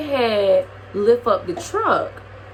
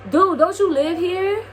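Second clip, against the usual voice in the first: pitched up, disbelieving; incredulous; 8 semitones higher than usual. Real, not acted. Asking the guy who lives there, pitched up in disbelief. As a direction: ask it like you can't believe it.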